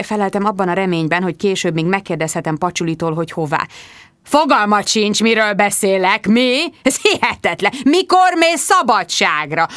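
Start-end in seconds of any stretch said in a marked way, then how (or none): 4.2-7.7 hangos, ingerült kérdés; bosszúsan ráförmed; ingerült főnök. A narrator's reading. 7.8-9.7 hangos kérdés. A narrator's reading. A főnök ingerülten kiabál.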